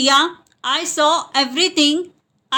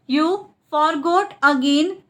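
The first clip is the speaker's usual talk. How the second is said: split repeat. Shown as a demonstration. Teacher repeats the translation for learners, split up. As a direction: pause after "You", then "forgot again".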